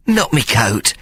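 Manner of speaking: small pleading whimper. Acted. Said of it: "he whimpered".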